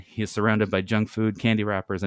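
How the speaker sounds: ordinary delivery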